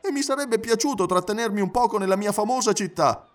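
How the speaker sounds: high, animated valet's voice; talkative Passepartout, regretful. Acted. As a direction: recount the rushed visit in a lively high pitch. Character recounts rushed Paris visit.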